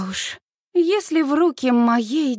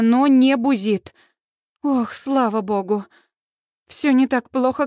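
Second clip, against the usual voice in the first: ordinary delivery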